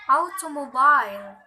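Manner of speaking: "automobile" with AU stressed most, BILE secondary; teacher's model "automobile", strong first syllable, lighter stress on last. Shown as a demonstration. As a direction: demonstrate "automobile", hitting the opening AU hardest. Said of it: "primary, unstressed, unstressed, secondary".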